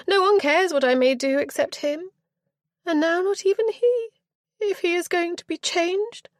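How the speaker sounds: put-on high, loud aggrieved girl; petulant young girl. A narrator's reading. Spoilt girl justifying her flight to the aunt, aggrieved. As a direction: whine it out indignantly. Richenda voiced high throughout.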